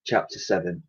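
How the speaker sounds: plain reading voice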